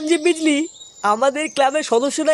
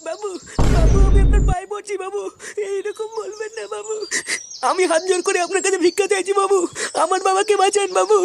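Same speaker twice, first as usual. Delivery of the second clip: pleading; 5 semitones higher than usual. Acted. Begging to save father.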